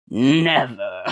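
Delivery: loud leering villain cackle; evil laugh; villain's leer and laugh. Acted. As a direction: sneer then cackle. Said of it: "leered the brute".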